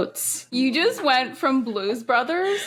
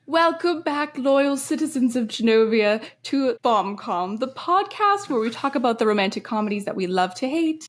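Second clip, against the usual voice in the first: upbeat show opener; podcast host intro; 3 semitones higher than usual. Acted. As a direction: welcome listeners like a host.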